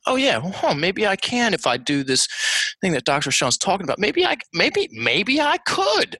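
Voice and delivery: high, musing, dawning possibility; hesitant, high-pitched thinking aloud; tentative maybe. Acted. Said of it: "You hear the difference?".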